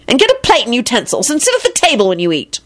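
high-pitched cross scolding; acted angry telling-off. A narrator's reading. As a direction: lay down the law, exasperated. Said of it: "Elizabeth said angrily".

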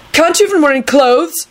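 high-pitched indignant blurt; angry blurt. A narrator's reading. Neville to Rosie in her nightgown, angry high blurt. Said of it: "he blurted out angrily".

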